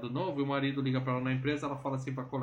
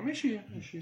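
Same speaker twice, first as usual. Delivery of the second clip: put-on timid girl; 6 semitones higher than usual. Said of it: "ela fala".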